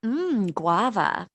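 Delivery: slow, relishing sniff of guava; savoring. Pretend. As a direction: draw it out, savoring the scent. Sniffing panties, savoring smell.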